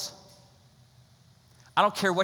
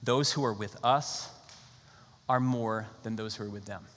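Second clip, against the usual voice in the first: calm reassurance; 6 semitones lower, 6 dB quieter than usual. Retold or imitated. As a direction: settle a frightened servant quietly. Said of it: "He looks at his servant and says".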